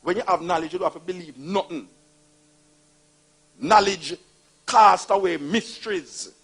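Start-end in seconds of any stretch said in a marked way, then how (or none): 3.6-6.3 loud, slow, drawn-out proclamation; loud preaching. Acted. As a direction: hammer home each word. Preaching it again, louder and slower.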